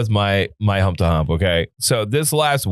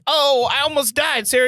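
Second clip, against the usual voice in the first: much higher, hammed-up victim; mock dramatic; 12 semitones higher than usual. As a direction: overplay the near death whine. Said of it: "and be like".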